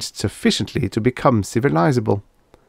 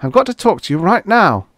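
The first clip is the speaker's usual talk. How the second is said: pleading; 10 semitones higher, 3 dB louder than usual. A narrator's reading. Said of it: "pleaded Farnham".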